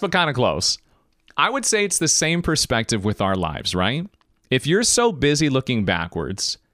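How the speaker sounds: ordinary delivery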